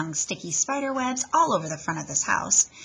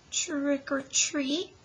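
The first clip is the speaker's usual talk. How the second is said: scared squeak; 8 semitones higher, much slower than usual. Acted. Froggy squeaking trick or treat to the wicked witch, high and slow. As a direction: barely get the words out. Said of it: "squeaked Froggy".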